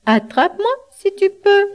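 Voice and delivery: high sing-song taunt; playful catch-me chant; taunting galette. A narrator's reading. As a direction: tease in a high little voice. Galette taunts wolf, then flees.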